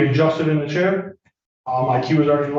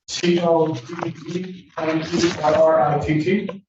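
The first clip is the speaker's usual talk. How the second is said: spelling out name; 2 semitones higher, much slower than usual. Real, not acted. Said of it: "please state and spell your name".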